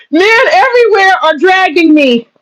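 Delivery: hammed-up victim whine, voice cracking; mock whiny wail. Mocking feminists, she wails a fake sob of complaint. Mock whining about men dragging her.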